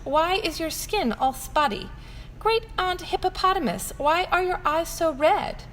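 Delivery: little elephant questioning her great aunt; curious elephant child. Acted. Child voice asking relatives.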